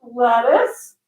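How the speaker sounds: put-on high, slow, drawn-out kid's squeal; imitating an ecstatic daughter's revelation; excited little kid. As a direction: stretch each word, building to a shriek. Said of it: "she's so freaking, like, she just won".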